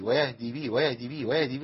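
ويهدي به gabbled fast and quiet; rushed reading. Shown as a demonstration. Teacher mocking a hurried reciter for students. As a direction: race through ويهدي به as if rushing. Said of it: "ما تنفعش، ما تجريش".

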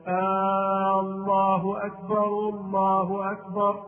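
drawn-out "آه" opening "الله", chanted slowly; questioning long first vowel. Shown as a demonstration. Teacher mimics a muezzin's mistake, question-like long first vowel. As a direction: stretch the first hamza of "الله" into "آه", like a slow call to prayer. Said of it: "إدخال همزة الاستفهام على لفظ الجلال".

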